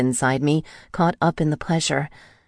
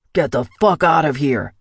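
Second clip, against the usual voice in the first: forceful, moderately raised shout; 4 dB louder than usual. A narrator's reading. Michael ordering an intruder out, shouted. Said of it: "Michael shouted".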